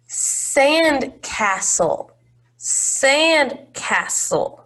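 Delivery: Sand castle stretched out slowly, SAND leaned on; slow, clear model word. Shown as a demonstration. Teacher slowly modelling a word for children. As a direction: draw out Sand castle so they hear the s. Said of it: "we actually hear the s in sand".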